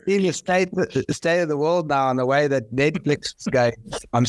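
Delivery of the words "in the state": normal talking voice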